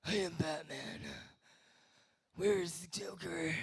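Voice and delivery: slow, rasping whispered Batman; hoarse gravelly caped crusader. Retold or imitated. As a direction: growl it like a hero with bronchitis. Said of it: "sounds like he's got bronchitis".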